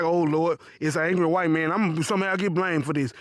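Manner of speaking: low, resigned dread; dreading cashier. Retold or imitated. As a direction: sigh it inward, worried.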